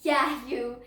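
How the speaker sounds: ordinary talk, nothing marked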